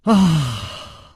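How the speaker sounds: long "ah" let out with a stretch; stretching sigh. A narrator's reading. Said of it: "楚留香伸了个懒腰". (伸着懒腰长叹一声)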